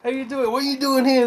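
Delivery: high, loud cheery voice; hammed-up salesman. Bud greets Will in a high hammed-up voice. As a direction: play the overeager salesman.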